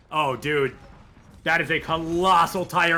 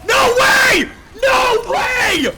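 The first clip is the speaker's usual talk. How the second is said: high, loud screaming disbelief; disbelieving shout; 12 dB louder, much slower than usual. Real, not acted. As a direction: scream it like you can't believe it. Caster stunned by huge play.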